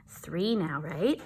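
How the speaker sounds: hushed whisper. Real, not acted. Teacher to the class, quiet whisper. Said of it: "whispered aside".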